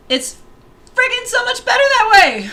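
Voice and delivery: excited. Real, not acted. Gushing about non-destructive workflow.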